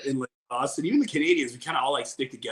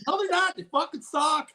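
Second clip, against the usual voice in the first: mocking high squeak. Mocking Philly fans, high squeak.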